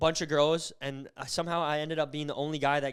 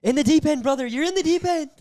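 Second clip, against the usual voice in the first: louder, much higher ribbing; teasing call-out; 9 semitones higher, 9 dB louder than usual. Real, not acted. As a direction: razz him loudly. Teasing friend about dating.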